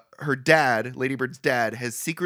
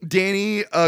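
ordinary talk, nothing marked

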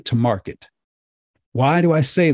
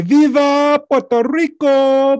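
long, much higher and louder rallying cry; rousing cheer; 13 semitones higher, 4 dB louder, slower than usual. Real, not acted. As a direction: shout it out slowly like a toast to the crowd. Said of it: "I'm gonna say".

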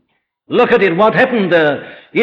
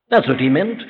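ordinary talk

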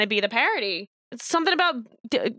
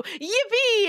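mock yippee; 10 semitones higher than usual. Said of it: "I read a Garfield comic strip and I go".

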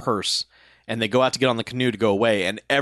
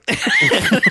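high giggle; 6 dB louder than usual. Real, not acted. Laughing at the scene.

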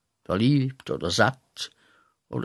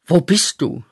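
verzweifelter Ruf; 5 semitones higher, 5 dB louder than usual. Acted. Ruft Gott.

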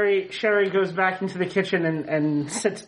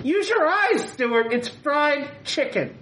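annoyed shout; 8 semitones higher, slower than usual. Acted. Mom snaps at dad in argument.

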